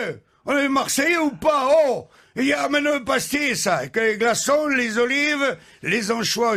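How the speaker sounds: captain proudly calling for pastis; proud exclamation. Acted.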